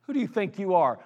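normal talking voice

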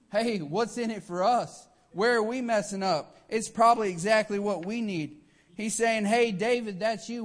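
loud and high, driving the point home; full-force preaching. Acted. Preacher urging the church to wake up, loud and high. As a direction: preach it hard to the congregation.

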